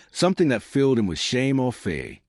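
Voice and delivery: usual speaking voice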